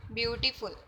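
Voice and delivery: quick, unbroken beautiful, syllables run together; in one breath. Shown as a demonstration. Teacher testing whether the word fits one breath, said quickly in one go. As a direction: try beautiful in a single go, no gaps. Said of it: "ਇੱਕੋ ਹੀ ਸਾਹ ਦੇ ਵਿੱਚ".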